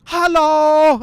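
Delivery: high, loud, booming jolly German greeting. Retold or imitated. Barrel man greets everyone in a terrible jolly German impression. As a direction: belt out a cheery beer-hall hello. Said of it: "I'm gonna do a terrible impression".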